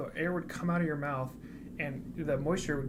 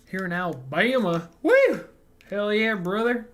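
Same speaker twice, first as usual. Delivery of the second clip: loud, higher whoop of Southern pride; hollering cheer; 6 semitones higher, 10 dB louder than usual. Acted. Streamer cheers a viewer in Alabama, hollering. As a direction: whoop it up, stretching the last words out.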